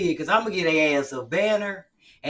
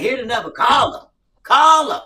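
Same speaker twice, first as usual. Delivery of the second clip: building louder and higher; mom daring; 5 semitones higher, 10 dB louder than usual. Retold or imitated. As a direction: dare the kid to call, getting louder. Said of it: "She be like".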